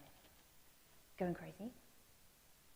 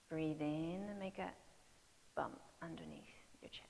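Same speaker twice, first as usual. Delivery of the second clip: guided breathing cues. Acted. Coach guiding kids through a breath, whispered. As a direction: talk the class through each breath, fading to a whisper.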